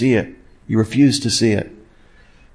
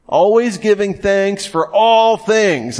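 ALWAYS and ALL raised high and drawn out; emphatic reading; 8 semitones higher, slower than usual. Shown as a demonstration. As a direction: punch "Always" and "all" as you read the verse. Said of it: "that's what we would call emphatic language".

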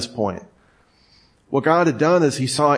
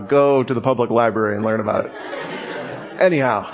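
laughing; 4 semitones lower, faster than usual. Real, not acted. Laughing after the clip.

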